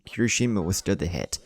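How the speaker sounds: ordinary delivery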